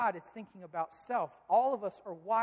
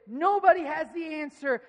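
mock child insistence; 7 semitones higher, 10 dB louder than usual. Son's stubborn claim, voiced.

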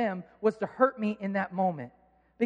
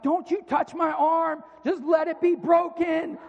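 high, drawn-out mock yell; slow, high-pitched protest, acted out; retold yelling; 6 semitones higher, 4 dB louder, slower than usual. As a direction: imitate a hurt kid yelling at the doctor. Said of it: "I'm yelling at the doctor".

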